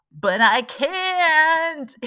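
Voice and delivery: high, drawn-out, stretched whine; helpless whine. Retold or imitated. Admitting she can't act on her intuition, high drawn-out whine. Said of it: "And I'm like".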